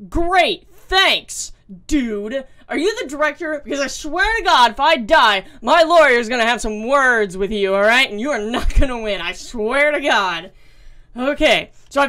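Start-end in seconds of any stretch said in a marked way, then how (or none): 0.1-10.5 real outburst, louder and louder threats; angry rant. Real, not acted. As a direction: chew out the director at full volume. Yelling at the game's director.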